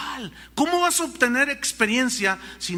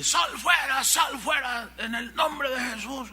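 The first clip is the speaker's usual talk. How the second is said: higher, commanding shout; exorcist shouting; 5 semitones higher, slower than usual. Retold or imitated. Youths shouting at the girl, mock exorcist shout. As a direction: bark out the command to the spirit. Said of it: "tenían a una muchacha ahí".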